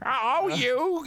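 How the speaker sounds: put-on high campy drunk drag queen; sassy drag queen. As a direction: camp it up like a tipsy drag queen. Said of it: "like a drunk drag queen".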